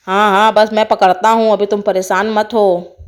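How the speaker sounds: low, put-on man's voice; deep-voiced charmer taking charge; reassuring snake charmer. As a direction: tell them not to worry, in deep character.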